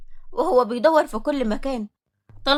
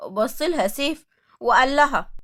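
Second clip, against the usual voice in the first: ordinary delivery, nothing marked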